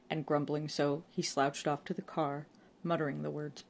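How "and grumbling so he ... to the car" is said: usual speaking voice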